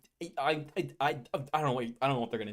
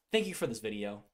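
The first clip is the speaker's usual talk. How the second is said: ordinary talk, nothing marked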